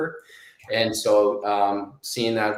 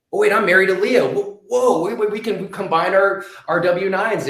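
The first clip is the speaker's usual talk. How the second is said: excited realization; 9 semitones higher, 5 dB louder than usual. Retold or imitated. Retells realizing they qualify.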